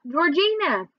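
high-pitched name call; mild, lifted call. A narrator's reading. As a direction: call your name ahead, lightly. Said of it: "I called out".

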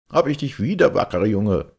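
high, loud glad greeting; hearty call. A narrator's reading. Breitenstein greeting young Georg, a loud high cheerful call. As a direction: call out delighted to see the lad. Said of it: "rief Breitenstein".

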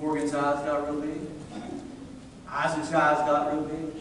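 low, soft storytelling aside; hushed suspense. Retold or imitated. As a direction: drop down low and quiet to build suspense. Hushed suspense before the scare.

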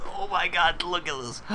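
high-pitched, thrilled amazement; excited awe. Real, not acted. Gamer showing viewers the game, excited awe. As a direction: gush at what has just appeared. Just got back into the game.

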